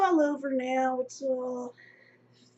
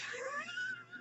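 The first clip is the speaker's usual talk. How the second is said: soft, high-pitched real laugh; helpless giggle cutting off the sentence; cracking up; 12 dB quieter than usual. Real, not acted. Cracks up recalling sandwich comment.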